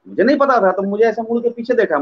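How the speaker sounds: ordinary delivery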